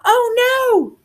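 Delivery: high-pitched cry from the mother; mother's high, drawn shout; shouted alarm. A narrator's reading. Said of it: "shouted Lucy's mother".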